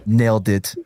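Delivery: ordinary talk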